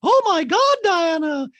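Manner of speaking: very high, loud mock delight; imitating Dorit gushing over Diana; mock gasp. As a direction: squeal at her friend's outfit. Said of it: "and Dorit goes".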